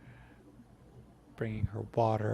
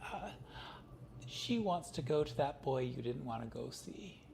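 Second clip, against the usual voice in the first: grandma voice; 6 semitones higher, 4 dB quieter than usual. Acted. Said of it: "Grandma goes".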